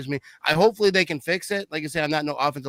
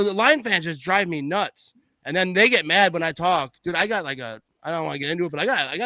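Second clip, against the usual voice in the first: normal talking voice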